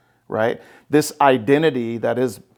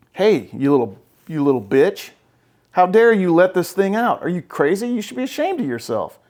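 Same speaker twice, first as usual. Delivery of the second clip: scolding inner voice; 6 semitones higher than usual. Retold or imitated. The inner voices berating the listener for letting emotions out, in a rising mock scold. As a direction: tear into yourself like a harsh inner critic. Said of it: "That's these three going".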